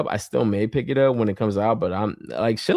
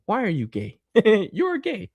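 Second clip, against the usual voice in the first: meme voice; 10 semitones higher than usual. Retold or imitated. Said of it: "it's like that meme".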